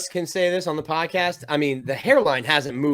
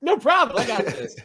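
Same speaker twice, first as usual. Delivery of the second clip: laughing mock boast; 7 semitones higher than usual. Mocking a coach through laughter.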